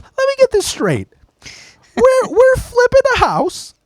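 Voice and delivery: very high, tight, throat closing with anger; like a huge wrestler squeaking with fury; high strangled rage. Retold or imitated. Imitating his boss scolding him, shrill strangled anger. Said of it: "his throat would close up with rage".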